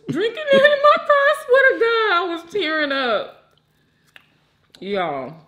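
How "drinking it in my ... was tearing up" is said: excited. Real, not acted. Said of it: "I almost cried".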